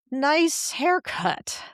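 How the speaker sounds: "nice haircut" drawn out very slowly, dragging nice; sarcastic insult. Shown as a demonstration. Coach demos the passive-aggressive nice haircut slowly. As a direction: stretch out "nice haircut" until it turns snide. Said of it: "little difference in the speed, in the tonality pattern".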